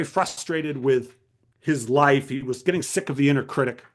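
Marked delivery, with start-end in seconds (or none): none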